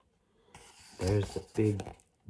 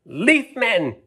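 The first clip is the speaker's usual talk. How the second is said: high, loud call for help; 12 semitones higher, 7 dB louder than usual. Acted. Doodlebug leader calling to the Leaf Men, loud high call. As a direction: call out across the forest. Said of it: "he called out".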